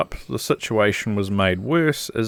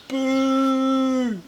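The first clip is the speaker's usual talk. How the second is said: high mock boo; playful boo. Real, not acted. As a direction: boo it like a heckler. Booing the faulty regulator.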